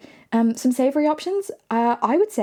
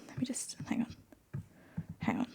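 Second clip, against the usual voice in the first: breathy whisper, half to herself; whispered hang on; whispered aside; 17 dB quieter than usual. Real, not acted. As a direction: drop to a whisper while busy. Muttering aside while checking texts.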